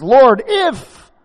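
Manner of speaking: high, loud, dramatic wail to the Lord; Martha's outcry. Acted. Martha confronting Jesus over her brother, dramatic outcry. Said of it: "Now Martha said to Jesus".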